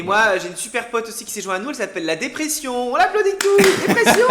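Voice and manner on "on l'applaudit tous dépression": high, loud laughing mock fanfare; mock announcement. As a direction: announce it like a game show guest. Mock applause for depression.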